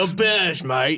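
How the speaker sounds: laughing Cockney tough-guy voice; Jason Statham voice. Retold or imitated. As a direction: do a Statham-style London accent. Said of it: "doing a Jason Statham voice".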